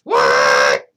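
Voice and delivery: loud startled yell; granny shouts in disbelief; full startled scream of what; shocked shout. Acted. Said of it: "cried Granny".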